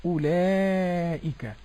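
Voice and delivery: أولئك with the madd held long; slow demo. Shown as a demonstration. Host showing the madd in أولئك, slow. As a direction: stretch the alif in أولئك. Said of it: "لام مفتوحة بعدها ألف مد".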